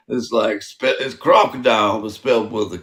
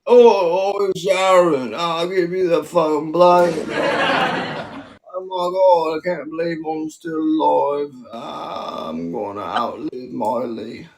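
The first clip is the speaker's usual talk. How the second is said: dazed English rock star impression, starting pitched up; 5 semitones higher than usual. Rock star calling out to [name], drunken impression. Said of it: "Babe, that's so good. Do you have, like, a [name]".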